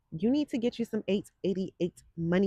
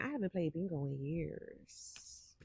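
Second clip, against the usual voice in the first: whispered and slow, much softer; 3 semitones lower, 11 dB quieter, slower than usual. Acted. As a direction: breathe it out like a secret confession. Confiding aside to viewers.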